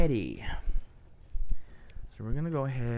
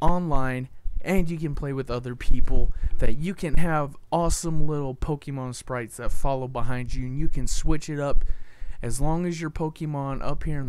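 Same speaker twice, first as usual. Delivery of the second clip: excited hype; 3 semitones higher, 8 dB louder than usual. Real, not acted. Hyping the game.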